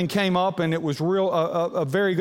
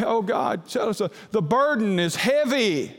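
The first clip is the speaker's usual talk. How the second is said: pleading prayer; 6 semitones higher than usual. Retold or imitated.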